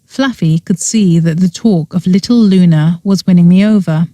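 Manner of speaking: usual speaking voice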